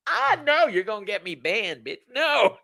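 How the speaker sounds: high, loud laughing no; laughing protest. Real, not acted. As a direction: squeal it off in protest. Laughing protest at own joke.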